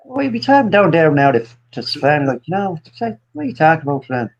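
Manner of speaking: chattering put-on Southern Irish; Southern Irish accent. Bewildered rambling at Sven for laughs, Southern Irish accent. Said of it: "Southern Irish".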